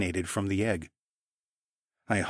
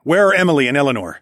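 urgent; 5 semitones higher, 8 dB louder, faster than usual. A narrator's reading. Demanding whereabouts.

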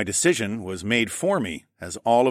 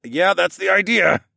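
loud, higher growl of effort; irritated, straining retort; 9 semitones higher, 6 dB louder than usual. Acted. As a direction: growl back while heaving a heavy lid. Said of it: "I growled".

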